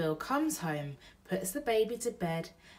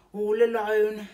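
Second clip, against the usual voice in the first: burglar voice; 5 semitones higher, 10 dB louder than usual. Acted. Bill pities the baby.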